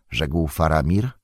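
ordinary delivery

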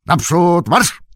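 high, loud marching order; parade-ground shout; commanding. A narrator's reading. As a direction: bark it like a drill command. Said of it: "zakomenderował Merry".

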